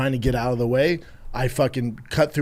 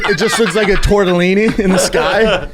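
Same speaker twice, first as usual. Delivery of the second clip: laughing; 7 semitones higher, 7 dB louder than usual. Real, not acted. Joking about Batman signal.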